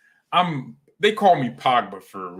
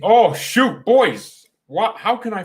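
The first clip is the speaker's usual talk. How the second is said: high, loud startled outburst; sudden shout; 10 semitones higher, 8 dB louder, slower than usual. Real, not acted. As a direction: blurt it out as you suddenly remember. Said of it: "Why am I shouting".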